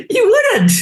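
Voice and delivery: high, loud cry of disbelief; mock yelling owner. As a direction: squeal it out in mock shock. Imitating owner yelling at dog.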